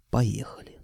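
soft whispered go-ahead; whispering. A narrator's reading. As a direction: barely breathe the word. Unvoiced far whisper. (шёпотом)

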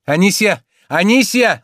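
high, loud call that builds; calling out shout. A narrator's reading. Master yells for the maid in another room, shouting. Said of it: "закричал Обломов".

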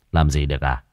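low, unimpressed retort; dry mocking echo. A narrator's reading. Master answers the possessed man's dare, coolly mocking. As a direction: throw the taunt back in a clean, low voice. Master echoes the taunt back.

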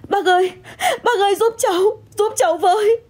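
very high, sobbing plea; tearful cry for help. Acted. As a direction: beg for help in panicked tears. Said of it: "nghe tiếng tôi gọi".

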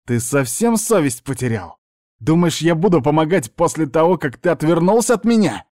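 angry outburst climbing high in pitch; growing spite. A narrator's reading. Niece to the uncle who turned away, spiteful. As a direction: build from indignation to high-pitched fury. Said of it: "продолжила с ещё большей злобой".

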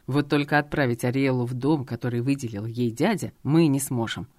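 low, cold commanding husband; stern dragon husband. Acted. Robert's reply.

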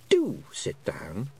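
slow queenly invitation; lightly voiced queen, drawn out; gracious Queen. A narrator's reading. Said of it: "she said".

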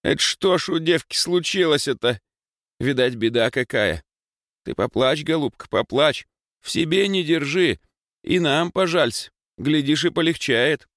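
high, lamenting village granny; баба Маша. A narrator's reading. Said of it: "запричитала баба Маша". (бабьи причитания)

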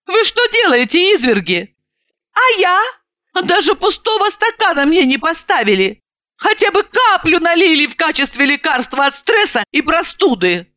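high-pitched, aggrieved outburst; indignant protest. A narrator's reading. Woman left without a glass protests to drinkers, indignantly.

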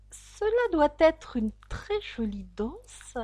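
slow, high, hesitant little voice; timid. A narrator's reading. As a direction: say it timidly, taking your time. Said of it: "dit timidement Alice". (dire timidement, lentement)

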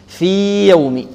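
في with a very long ee, then يوم; clear separated ya. Shown as a demonstration. Teacher shows clear ya, drawn-out unmerged recitation. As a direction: stretch في slowly, do not merge into يوم. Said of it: "وأبن يعني أظهر".

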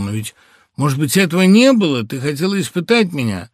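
high, loud trembling plea; pleading, trembling. A narrator's reading. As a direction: beg her to say it was not true. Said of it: "голос его стал умоляющим и задрожал". (умоляющий дрожащий голос мужа)

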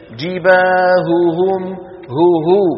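جباههم drawn out very slowly, both ه sounded clearly; clear double ha. Shown as a demonstration. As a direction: stretch جباههم to show the two ه. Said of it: "حرف الهاء".

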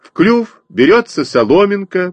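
high chirped repeat; chirping in chorus. A narrator's reading. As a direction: chirp it back like pupils. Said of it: "прочирикали мы с Костей хором".

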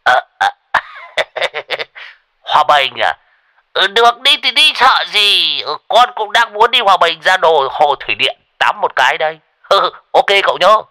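high, loud giggling agreement; shrill laughter. A narrator's reading. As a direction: cave in with a nervous giggle. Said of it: "cười lên choe choé". (cười choe choé)

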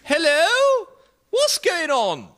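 put-on very high falsetto old-queen voice; comic high-pitched royal, questioning; Queen Victoria. Actor plays Queen Victoria arriving.

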